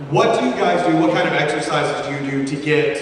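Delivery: ordinary talk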